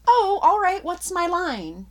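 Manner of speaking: high, loud, slow "what's my line" voice; hammed-up forgetful performer; mock ditzy actor. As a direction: play a scatterbrained actor who forgot. Mock actor losing place.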